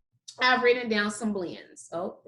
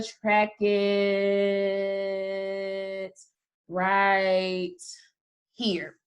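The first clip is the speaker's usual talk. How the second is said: words drawn way out with pauses; slow tracking; 4 semitones lower, much slower than usual. Shown as a demonstration. Teacher tracking a finger with the class, slowly drawn out. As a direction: stretch the words along with a moving finger.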